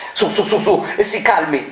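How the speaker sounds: calm her down, cautiously; nervous soothing. Acted. De Marchis nervously tries to calm the woman.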